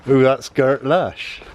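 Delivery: slow, relished West Country accent. Shown as a demonstration. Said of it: "I totally love a West Country accent".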